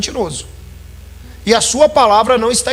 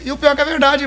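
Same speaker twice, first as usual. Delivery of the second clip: usual speaking voice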